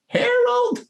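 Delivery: a wife yelling at Harold in a shrill-high voice; mock high shouting wife calling her husband; wife ordering husband. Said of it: "then you hear this voice say".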